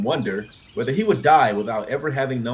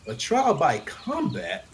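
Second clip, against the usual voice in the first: slow, heavy and higher; heavily; 9 semitones higher, slower than usual. A narrator's reading. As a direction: drag each word down with dread. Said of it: "Raymond said heavily".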